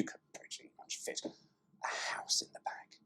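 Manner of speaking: breathy whispered aside. Acted. Cheeky whisper to viewers about the boot space. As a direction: drop to a sly whisper for the punchline.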